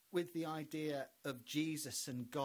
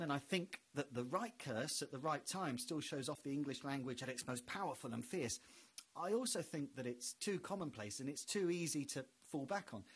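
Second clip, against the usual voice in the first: normal talking voice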